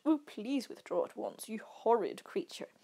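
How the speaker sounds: mock-genteel teasing voice; lightly theatrical mock courtesy; overly polite. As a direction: put on exaggerated politeness to poke fun. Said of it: "the really overly polite".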